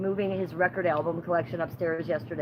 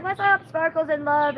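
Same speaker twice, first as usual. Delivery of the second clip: higher and slower, a sing-song hello; bubbly greeting; 9 semitones higher, 5 dB louder than usual. Retold or imitated. She greets the streamer in a bubbly high voice. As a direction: gush a cheery hello into the stream. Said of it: "I was like".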